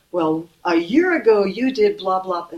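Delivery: mock grudge. Teacher imitating someone dredging up last year's hurt, mock accusing. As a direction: throw an old grievance back, half-mocking. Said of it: "Or holding on to it".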